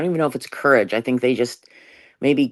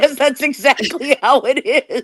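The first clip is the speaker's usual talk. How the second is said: laughing; 12 semitones higher, 3 dB louder than usual. Real, not acted. Laughing agreement with friend.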